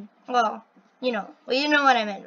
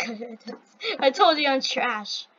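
ordinary talk

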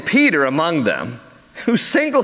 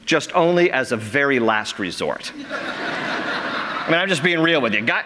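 ordinary talk, nothing marked

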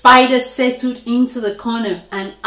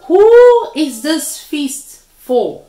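slow, loud, higher question; pointed question; 6 semitones higher, 8 dB louder, slower than usual. A narrator's reading. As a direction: ask it slowly and pointedly. Great Spirit asks pointedly.

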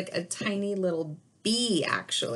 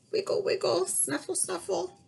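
much higher, softening snuffles; playful piglets; 12 semitones higher than usual. Acted. Teacher voicing the piglets for the class, high and fading. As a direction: squeak it like wriggling piglets. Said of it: "And it says".

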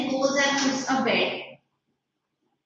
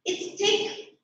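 put-on a higher, crisp well-bred young gentleman; crisp gentlemanly voice; 7 semitones higher, 4 dB quieter than usual. Harold complaining to his father in a crisp gentlemanly voice. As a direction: clip it like a proper little gentleman. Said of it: "in the crisp, gentlemanly voice".

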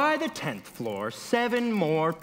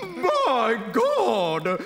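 higher, alarmed woman's cry; 4 semitones higher, 4 dB louder than usual. A narrator's reading. Woman about to faint in the robbery, alarmed cry. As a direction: cry out in hammed-up horror. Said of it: "cried one woman".

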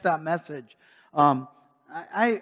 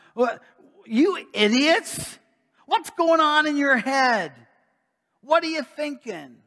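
higher, incredulous mock scolding; exasperated scolding; 8 semitones higher than usual. Paul scolding the Galatians, higher and exasperated. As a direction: scold them like a fed-up teacher. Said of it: "he's saying to them".

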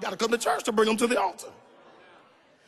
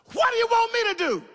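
a raised prophet's cry; Elijah calling out; 10 semitones higher than usual. Acted. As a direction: call it out as Elijah to the woman. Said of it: "And Elijah said unto her".

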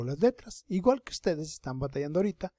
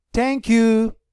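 put-on louder, higher boy saying thanks; a hint of a grateful boy; voicing the boy; 8 semitones higher, 10 dB louder than usual. Teacher plays the boy thanking the girl, lightly voiced. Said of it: "nuestro niño le va a dar las gracias".

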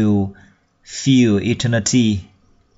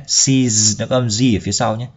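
normal talking voice

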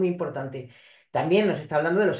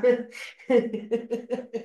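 laughing; 4 semitones higher than usual. Real, not acted. Laughs at the tit-for-tat.

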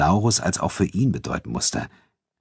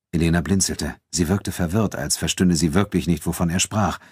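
ordinary delivery

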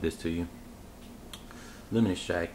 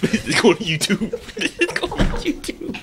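laughing, high and loud; 13 semitones higher, 6 dB louder than usual. Real, not acted. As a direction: crack up while announcing it. Laughing excited repeat.

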